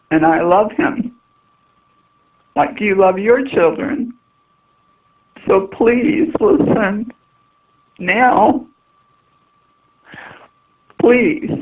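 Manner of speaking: high, slow, halting plea; desperate pleading. Real, not acted. Mother begging listeners to heed her for her child, pleading. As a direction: beg from the heart, pitch climbing, long pauses.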